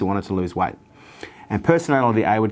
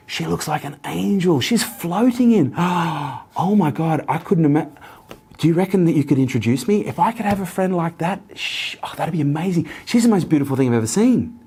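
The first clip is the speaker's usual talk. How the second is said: much higher, starry-eyed gush; gushing admiration; 7 semitones higher than usual. Retold or imitated. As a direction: rave about her like an angel walked in. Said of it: "she'd go".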